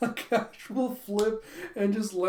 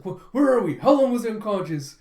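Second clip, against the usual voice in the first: disoriented alarm; 3 semitones higher, 8 dB louder than usual. Retold or imitated. Lloyd waking in the park, loud confused questions. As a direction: come to in a panic. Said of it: "He's like".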